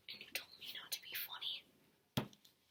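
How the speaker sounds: hushed whispered retort. Real, not acted. Son whispering a retort to his dad. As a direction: whisper it back at him.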